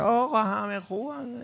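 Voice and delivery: slow, high-pitched mocking quote; put-on high voice, drawn out; mimicking others. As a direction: parody a naive, all-accepting view. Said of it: "من دیدم بعضی میگن".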